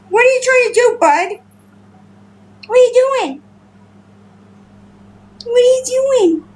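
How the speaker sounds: high-pitched cooing to a pet; pet talk. Real, not acted. Cooing questions at pet guinea pig.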